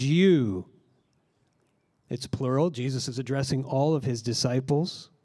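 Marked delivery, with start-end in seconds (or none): 0.0-0.7 you said loud and set apart; emphatic you. Shown as a demonstration. Pastor repeating the emphatic word for listeners, stressed you. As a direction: give you its full weight alone. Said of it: "It's first in the sentence".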